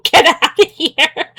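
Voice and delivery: half-joking getaway call; laughing urge. Real, not acted.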